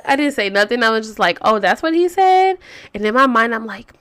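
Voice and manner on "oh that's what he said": feigned casual surprise. Retold or imitated. Outward reply hiding her real reaction.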